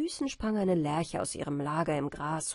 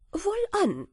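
landlord agreeing; 12 semitones higher, 4 dB louder than usual. A narrator's reading. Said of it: "sagte der Gutsherr".

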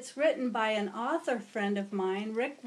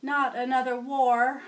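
sighing; 2 semitones higher, 7 dB louder than usual. Acted. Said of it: "Mister President sighed".

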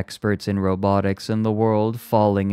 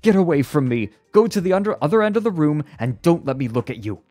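high, loud, fast outburst; shriek; 9 semitones higher, 4 dB louder, much faster than usual. A narrator's reading. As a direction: shriek furiously to drive the robot off. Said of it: "shrieked at the robot".

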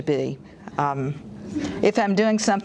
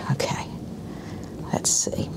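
whisper; 3 semitones lower, 6 dB quieter, slower than usual. Real, not acted. Muttering to herself.

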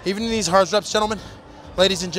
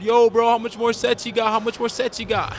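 gym bro asking; 8 semitones higher than usual. Retold or imitated. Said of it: "it get a little awkward".

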